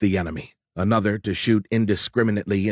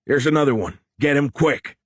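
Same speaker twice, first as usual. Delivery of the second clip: urgent call; 6 semitones higher, 4 dB louder than usual. Acted. Sailor spotting a survivor.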